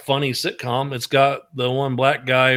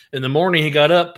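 usual speaking voice

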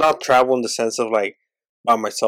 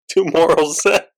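laughing nervously, pitched up; 4 semitones higher, slower than usual. Real, not acted. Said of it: "he starts laughing a lot".

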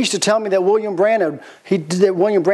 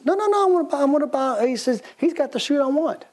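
high put-on voice, stubborn; a much higher playful voice, refusing to leave; insistent Branham; 9 semitones higher than usual. Branham insists on buying suit.

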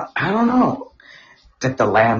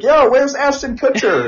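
dumbstruck prank victim; 12 semitones higher, slower than usual. Retold or imitated. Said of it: "be like".

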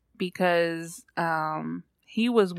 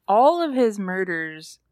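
usual speaking voice

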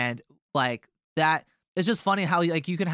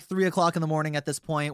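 ordinary talk

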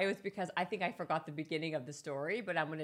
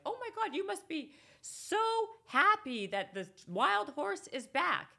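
much higher, louder excited villager; excited neighbors; 14 semitones higher, 4 dB louder than usual. Retold or imitated. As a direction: gush like an overjoyed neighbor. Neighbors voiced in story.

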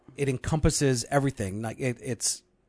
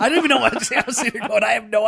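laughing; 7 semitones higher, 3 dB louder, faster than usual. Real, not acted. Host cracks up at own confusion.

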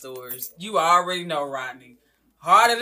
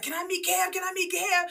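high eager begging, pitched way up; eager fan begging to meet Cam; eager asking; 14 semitones higher than usual. Retold or imitated. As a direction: plead excitedly like a fan. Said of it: "when I was like".

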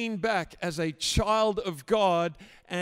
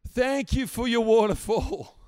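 a raised, fervent prayer of thanks; spoken prayer; 4 dB louder than usual. Shown as a demonstration. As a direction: lift the thanks up boldly, like worship. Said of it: "why don't you just say".

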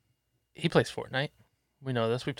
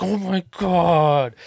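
higher, drawn-out mock wail; imitated crying kid; 4 semitones higher, 4 dB louder, much slower than usual. Kid losing his headset to dad, mock-tearful. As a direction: mimic an upset child's slow wail. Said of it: "He starts getting all sad and crying and shit".

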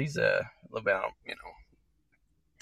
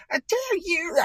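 ordinary talk

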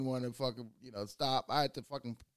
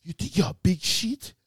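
angry dad; 4 semitones higher, 6 dB louder than usual. Retold or imitated. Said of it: "he was like".

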